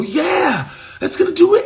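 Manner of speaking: high-pitched eager "yeah"; mock-thrilled, buying the hype; gullible excitement. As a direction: pitch way up, like a buyer totally sold. Acts out his eager buyer reaction.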